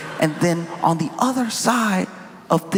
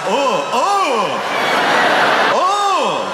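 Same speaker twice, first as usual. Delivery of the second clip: flustered John; 6 semitones higher, 4 dB louder, much slower than usual. Retold or imitated. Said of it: "He really was like".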